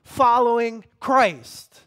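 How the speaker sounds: loud, slow preacher voicing God; high, forceful pronouncement from the pulpit; God's rebuke. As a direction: hammer each phrase out with space between. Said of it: "God's like".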